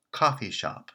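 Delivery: coffee shop with the pitch on coffee; stress on coffee. Shown as a demonstration. Teacher demonstrating compound stress on coffee. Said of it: "The pitch is on the first word".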